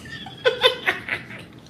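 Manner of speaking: high airy giggle; a quick breathy laugh at herself; breathless laugh. Real, not acted.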